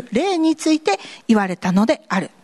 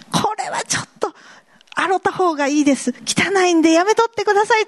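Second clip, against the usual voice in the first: much higher, fast, flustered; embarrassed refusal; 9 semitones higher, faster than usual. Pretend. A believer asking to wash it first, high and flustered. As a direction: rush the excuse out, mortified.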